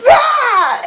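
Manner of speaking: high, loud effort shout; power yell. Retold or imitated. Casting the spell again at the monster, high loud effort shout. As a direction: put your whole force behind it. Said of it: "a little more motivation".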